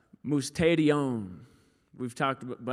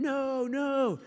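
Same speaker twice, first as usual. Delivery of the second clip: put-on crying toddler; 11 semitones higher than usual.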